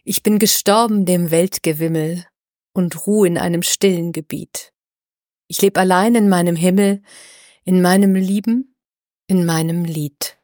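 put-on a measured, solemn reading of old verse; poem recitation. [name] reads the Rückert poem to open the episode, in solemn recitation.

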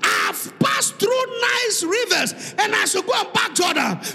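loud, shouting sermon delivery; fiery preacher at full volume; full-force preaching. Acted. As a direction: preach it hard to the congregation.

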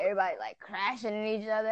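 ordinary talk

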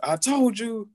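very high, crowing retort; triumphant vindication. Retold or imitated. Patient to commission after normal results, triumphant. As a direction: re-enact a high-pitched told-you-so.

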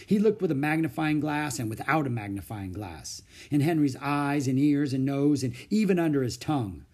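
ordinary delivery, nothing marked

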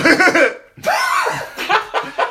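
loud villain cackle shooting up very high; Keanu-style Joker laugh. Acted. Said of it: "He's got, he gets higher".

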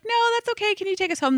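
very high, pleading mock politeness; retold polite refusal. Riders declining the swingers' drink, pleading high for a ride home. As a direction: imitate a nervous, high-pitched decline.

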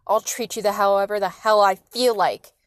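loud, defiant retort; forceful, domineering anger; angry father. A narrator's reading. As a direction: throw it back angrily as the dad. Father snarls back in quarrel.

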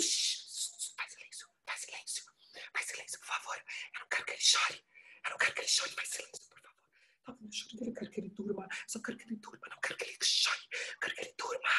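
desperate hushed whisper; whisper, begging the room for silence. Acted. Mother hushing, fearing baby's cry.

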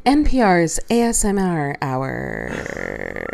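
slow, low creaky radio voice; vocal fry. Pretend. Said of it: "See, vocal fry".

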